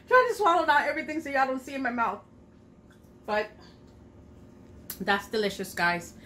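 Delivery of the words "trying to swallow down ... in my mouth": fast, laughing through a mouthful. Real, not acted. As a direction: giggle while swallowing food. Laughing while swallowing food.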